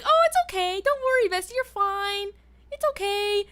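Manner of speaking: high, cooing comfort; sing-song reassurance to a friend; reassuring. Retold or imitated. Acting out own reassuring reply.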